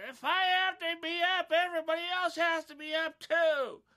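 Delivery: high, loud imitation of a cousin yelling at dawn; mock yelling cousin. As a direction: yell it like an angry cousin woken early. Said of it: "He's like".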